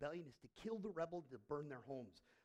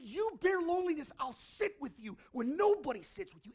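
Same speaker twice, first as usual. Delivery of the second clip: passionate vow; 11 semitones higher, 14 dB louder than usual. Retold or imitated. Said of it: "He said".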